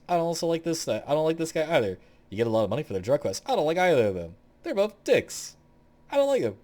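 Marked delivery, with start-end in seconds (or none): 0.1-2.0 higher, emphatic dislike; annoyed rant. Real, not acted. Gamer ranking characters they dislike, annoyed. 3.4-6.6 high, loud, emphatic dislike; annoyed rant. Real, not acted. Gamer venting about two disliked characters, loudly annoyed.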